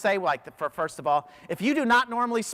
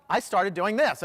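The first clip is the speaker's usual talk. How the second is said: ordinary delivery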